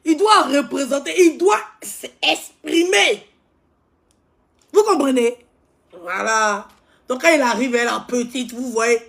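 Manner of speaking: high, loud, fast-paced gossip rant; ranting loud about a show-off; loud animated rant. Acted. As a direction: hype it up, loud and worked up.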